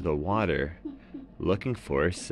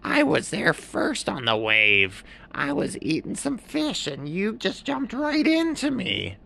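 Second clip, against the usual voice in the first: high, slow fussy bird voice; a put-out heron scolding, pitched high; blue heron. A narrator's reading. Narrator voicing the heron complaining to the seal, high and slow. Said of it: "And the blue heron said".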